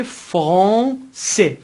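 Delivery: فرانسه stretched, nasal middle, clear final se; teacher splitting فرانسه before the last syllable; slow French demo. Shown as a demonstration. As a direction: sound out فرانسه slowly in French.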